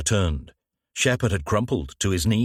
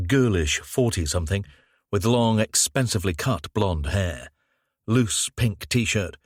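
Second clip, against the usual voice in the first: usual speaking voice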